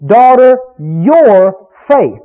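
"your" drawn out and punched, set apart by pauses; stress on YOUR. Shown as a demonstration. Preacher showing what Jesus emphasized, heavy stress on "your". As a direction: lean hard on the word "your". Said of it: "emphasize here".

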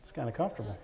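low, quiet mock comfort; soft mocking. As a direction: murmur it like lazy comfort. Mocking excuses.

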